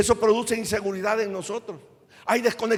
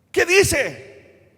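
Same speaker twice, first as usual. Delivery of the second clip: higher and louder, punched; forceful question; 7 semitones higher, 5 dB louder than usual. Acted. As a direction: hit it hard as a challenge. Predicador repite la pregunta con fuerza. (pregunta enérgica, más aguda y fuerte)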